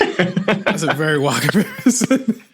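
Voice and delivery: real laughter breaking into the words; laughing. Real, not acted. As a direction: talk through a laugh, drawn out slowly. Cracking up at the comparison.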